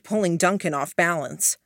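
plain reading voice

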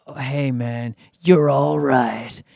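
low, slow, understated approval; mock grudging respect. Mexican comic nods to his rival after the insults, mock grudging respect. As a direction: draw it out low, like a cool nod.

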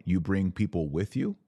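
ordinary talk

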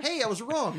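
high, lively retold exclamation; pleasant surprise. Host reading notes on a better track, brightly surprised. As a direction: perk up sharply on "Hey". Acting out his change of mind.

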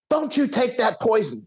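put-on high warning voice of an old chief; old chief's warning. Old chief warning the visitor off the vaccine, high stern voice. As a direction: warn him off like a stern elder. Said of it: "he goes".

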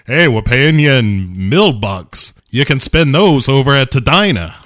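hammed-up small-town employer; company-town boss. Acted company-town boss quote.

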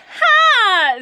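high shouted "ha" yelp; sharp loud "ha". Retold or imitated. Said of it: "And I did this, like".

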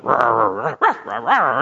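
imitated dog growls and barks; barking, growling dog. Dog joining a heated family argument, barking and growling. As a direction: growl and bark like an agitated dog. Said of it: "he's barking and growling".

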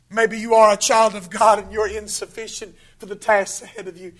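usual speaking voice

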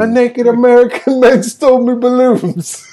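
high mock child voice, laughing; amused take on a small kid's complaint; little boy. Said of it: "the little boy goes to his mom".

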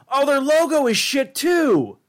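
high, slow, loud disgusted rant; outraged rant. Real, not acted. Host ranting to co-host about a rival's logo, outraged. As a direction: wail at how bad the logo is.

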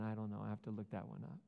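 ordinary talk

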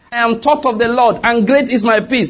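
proper recited answer. Retold or imitated. Daughter correcting herself to her mother, recites proudly. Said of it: "He said, "Good".

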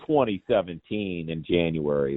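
ordinary talk, nothing marked

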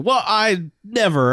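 slow, high-pitched "well I never"; the hero balks at the CIA loan, mock-affronted; indignant huff. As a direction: drag it out like an offended aristocrat.

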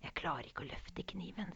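frightened hushed whisper. Acted. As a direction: breathe it, barely a whisper. Mother scared at troll's table. (redd hvisking)

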